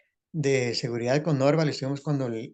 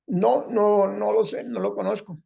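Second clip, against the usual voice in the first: high, strained put-on voice; high squeezed character voice; [name]'s changed voice; 6 semitones higher, slower than usual. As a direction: pitch it up into a thin, odd voice. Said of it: "Ahí nace eso de que el cambio de voz".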